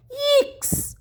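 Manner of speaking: high squeaky yelp of fright; frightened yelp. A narrator's reading. Boy in the boat sees bluefish, shrill yelp of fright. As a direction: squeal in alarm.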